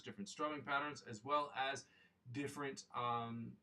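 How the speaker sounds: usual speaking voice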